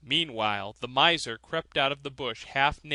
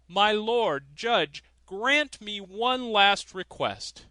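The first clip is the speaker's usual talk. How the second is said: higher, louder and slow formal request; solemn plea; 6 semitones higher, 5 dB louder, slower than usual. A narrator's reading. Countryman on the gallows steps asking the judge, slow and solemn. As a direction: plead with dignity from the gallows.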